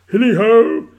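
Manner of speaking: loud, high, jovial whoop; full-voiced hearty halloo; Fezziwig. A narrator's reading. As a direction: holler it out like a merry old boss. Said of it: "cried old Fezziwig".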